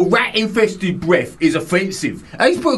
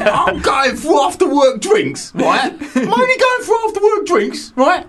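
mimicking a listener; 6 semitones higher than usual. Reading the listener's email, a light mocking higher voice. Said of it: "my nail varnish is just chipped".

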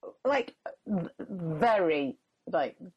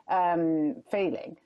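usual speaking voice

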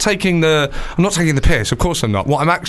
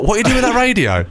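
mock angry shout; 8 semitones higher than usual. The bloke at the door confronting the card guy, mock angry shout. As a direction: bark it like a suspicious husband at the door.

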